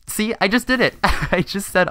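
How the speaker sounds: high, loud laughing exclamation; laughing self-catch. Real, not acted. Gamer catches himself saying um again, laughing. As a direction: burst out laughing at your own slip.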